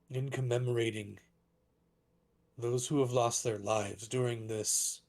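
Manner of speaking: measured proclamation with long pauses; lightly voiced dragonborn orator, halting and grave; solemn public address. Dragonborn councillor addressing mourners at a vigil, solemn proclamation. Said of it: "very loud, probably magically amplified voice".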